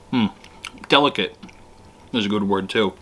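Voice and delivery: muffled words between bites; chewing while talking. Real, not acted. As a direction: mouth partly full of food. Seen 0: Fork enters mouth at -1; lips pressed, jaw working through early seconds.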